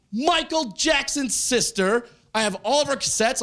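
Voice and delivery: high, excited fan outburst; mock starstruck gushing. Starstruck fan reaction, retold.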